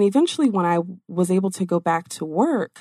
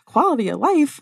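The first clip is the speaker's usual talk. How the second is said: incredulous retort; 7 semitones higher, 4 dB louder than usual. Retold or imitated. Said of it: "I looked at him and I'm like".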